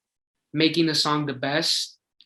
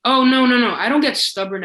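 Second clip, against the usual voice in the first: emphatic denial; 8 semitones higher, 6 dB louder than usual. Real, not acted. Interviewer suggests he's stubborn.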